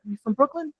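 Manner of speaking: a quick jokey Brooklyn accent impression; mild mock Brooklyn New York accent. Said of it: "I know what that accent sounds like".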